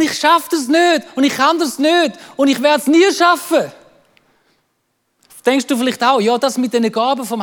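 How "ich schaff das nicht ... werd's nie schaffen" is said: high, loud imitated protest; acting out a despairing kid; defeated protest. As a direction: pile up a child's frustrated refusals. Son's repeated protest, retold.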